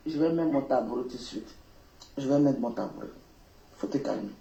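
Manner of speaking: low, softly spoken reassurance; real soothing. Real, not acted. Telling an upset caller to calm down, low and soothing. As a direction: settle them down in a low, soft voice.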